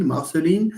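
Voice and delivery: Marceline ending in "leen"; Marceline said as "mar-suh-LEEN". Shown as a demonstration. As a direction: say Marceline with a long ee at the end. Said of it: "Correct me if I mispronounce this".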